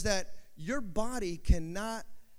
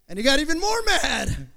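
higher and louder, building to a shout; preaching shout; 6 semitones higher, 9 dB louder than usual. Acted. Preacher shouting to the congregation about the teammate's rage. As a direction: hit the climax with full force.

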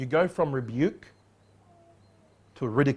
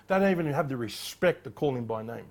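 ordinary delivery

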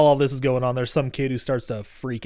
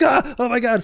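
higher, loud retold cry of pain; mock scream; 10 semitones higher, 4 dB louder than usual. Retelling the bitten teacher on the gurney, mock scream.

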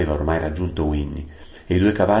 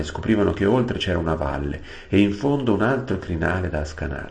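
plain reading voice

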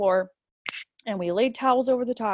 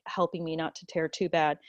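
normal talking voice

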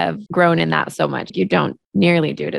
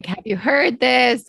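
slow, rising question tune, pitched higher; unsure rising question; hedging question; 6 semitones higher, slower than usual. Retold or imitated. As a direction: lift the end like a timid question. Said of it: "I might actually just pose it as a".

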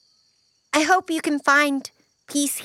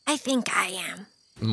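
teary; 7 semitones lower, 6 dB quieter, slower than usual. Acted. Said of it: "with a tear rolling down".

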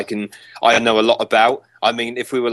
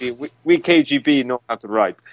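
joking Russian KGB voice; mock Russian accent; 2 semitones higher than usual. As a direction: put on a playful Russian accent. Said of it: "That's Russian, yes".

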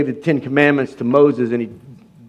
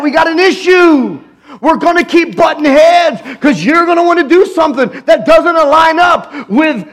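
higher, loud fervent preaching; preaching at full force; 9 semitones higher, 3 dB louder than usual. Real, not acted. Preacher to congregation, fervent full-force preaching. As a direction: preach it hard and passionate.

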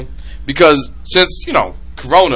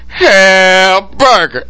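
drawn-out punchline; 2 semitones higher, much slower than usual. Retold or imitated. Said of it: "two seconds later".

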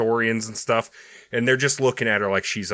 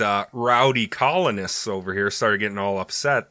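usual speaking voice

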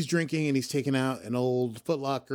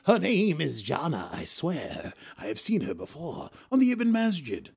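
acted Rios; 4 semitones higher than usual. Acting out Rios in a scene.

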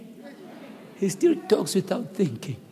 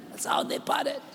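breathy whispered repeat, much softer; 8 dB quieter than usual. Shown as a demonstration. Preacher hushing the room over Peter's parting, whispered. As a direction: drop to a hushed whisper for "parted". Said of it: "repeat of line 31 whispered".

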